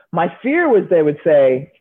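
ordinary talk, nothing marked

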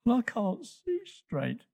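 high, soft little voice; small, high voice of a hurt youngster; hurt little Toto. Acted.

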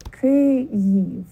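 Kyiv as "Ky... yiv", two parts held apart; syllables slightly separate. Shown as a demonstration. Teacher putting it together, Kyiv in two separated syllables. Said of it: "So now we put it together".

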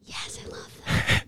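slow unvoiced whisper. Real, not acted. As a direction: whisper it gently.

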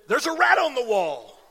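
sudden alarmed call; startled exclamation. Retold or imitated. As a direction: blurt it out as if just waking. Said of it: "pointed up and said".

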